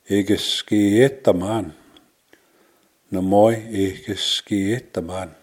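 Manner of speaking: slow "ikke skete man", every syllable drawn out; careful, stretched "ikke skete man"; slow teaching demo. Shown as a demonstration. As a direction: model "ikke skete man" slowly for learners. Teacher models phrase slowly for learners.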